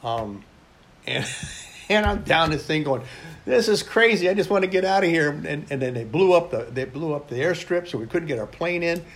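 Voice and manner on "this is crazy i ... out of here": higher, louder and fast, like he wants out now; frantic cry under fire; panicked outburst. Retold or imitated. To himself in the trench under rockets, panicked high outcry.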